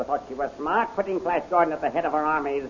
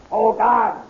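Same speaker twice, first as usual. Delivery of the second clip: loud, high angry exclamation; furious outburst; 8 semitones higher, 10 dB louder than usual. Acted. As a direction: explode in rage. Magician erupts at prisoner.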